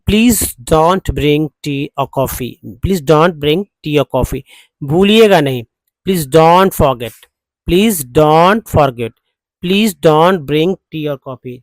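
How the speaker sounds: slow, emphatic model sentence; drill repetition. Shown as a demonstration. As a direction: say it slowly for learners to repeat. Teacher models sentences for repeated practice. (धीरे-धीरे, ज़ोर देकर अभ्यास कराते हुए)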